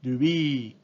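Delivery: rubí as rubi, long final i; rubí with a flat Japanese i; Japanese loanword. Shown as a demonstration. Teacher giving the Japanese reading of ruby: ルビー. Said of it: "se convierte en".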